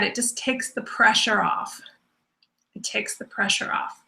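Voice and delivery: soft, near-whispered reassurance; soothing release. Real, not acted. Teacher easing students' worry about doing enough, soothing and hushed. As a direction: let it fall away quietly, easing the listener. Guiding listeners to settle and breathe.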